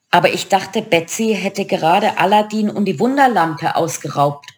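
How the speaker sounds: ordinary delivery, nothing marked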